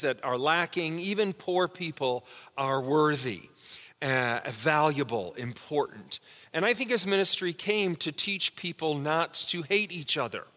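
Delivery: normal talking voice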